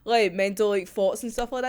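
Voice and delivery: normal talking voice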